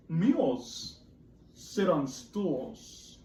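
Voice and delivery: very slow, drawn out; slow cat line. Acted. Teacher reading cat's rhyme very slowly. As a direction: stretch every word. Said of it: "said the cat".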